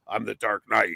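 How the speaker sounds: serious Dark Knight. Retold or imitated. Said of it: "the serious".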